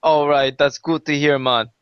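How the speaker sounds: shaky attempt at a Jamaican accent that drifts partway. Caster greeting his co-host in a bad Jamaican accent. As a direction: lay on a loose Jamaican accent, letting it slip toward Indian. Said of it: "You cannot do a Jamaican accent".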